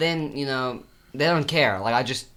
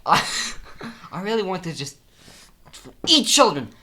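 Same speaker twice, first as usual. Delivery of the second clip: higher, louder unhinged cry; mock outburst; 6 semitones higher, 7 dB louder than usual. Patient blurting to therapist, loud mock outburst. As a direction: blurt it out like a madman. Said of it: "patient outburst".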